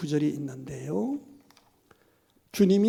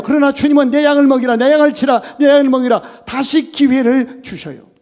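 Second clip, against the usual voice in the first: loud, higher, driving sermon voice; fervent preaching; 8 semitones higher, 12 dB louder than usual. Acted. Pastor warns the congregation, loud fervent preaching. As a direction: preach it out with full force.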